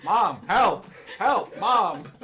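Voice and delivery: loud but unpanicked mock call; imitated calm kid calling. Kid by the counter wants Mom, imitated calm calling. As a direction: call for Mom in measured, sing-out words. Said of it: "in a very calm voice".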